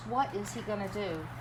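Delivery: ordinary talk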